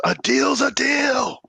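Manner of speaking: high, loud, slow bellow; shout. Acted. Omar silences the grumbling campers, shout. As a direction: shout it over the grumbling crowd. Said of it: "And he shouts".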